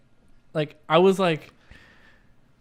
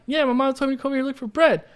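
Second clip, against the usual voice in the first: put-on high-pitched naive kid answering a stranger; innocent little kid; 8 semitones higher than usual. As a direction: play yourself as an innocent child. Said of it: "Being a little freaking innocent little kid".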